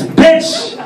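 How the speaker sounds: high, punched-out mock insult; defiant insult. Santa talking back to his wife, high defiant punch. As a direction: throw it out high and bold. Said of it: "And I'm all like".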